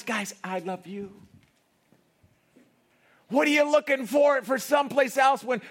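high, loud, driving preacher; full-force preaching. Acted. Preacher to congregation, loud and high. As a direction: pound out God's love to the crowd.

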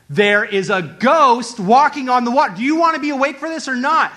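loud, high, incredulous announcement; urgent alarm. Acted. Disciple warning the others of a ghost, urgent alarm. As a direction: sound the alarm to groggy friends.

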